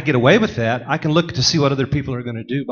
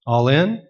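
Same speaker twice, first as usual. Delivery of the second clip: ALL IN rising steadily to a question; questioning inflection. Shown as a demonstration. Poker player leaving the dealer to interpret the bet, questioning. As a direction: say ALL IN as if asking, pitch climbing on IN. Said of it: "with an inflection as a question".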